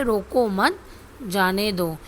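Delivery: रोको मत as one unit, short break before जाने दो; pause after मत. Shown as a demonstration. Teacher reading the third example, pause after मत. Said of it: "रोको मत के बाद अल्प विराम".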